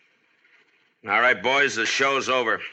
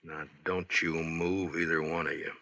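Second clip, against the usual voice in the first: quiet menace; 3 semitones lower, 7 dB quieter than usual. Acted. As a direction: hold them still with a quiet threat. Marshal holds gunmen at bay.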